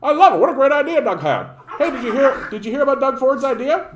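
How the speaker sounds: mocking Ford's fans raving about cheap beer, hammed-up glee; gushing dopey voter. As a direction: gush like a thrilled simpleton who loves cheap beer. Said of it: "And they're like".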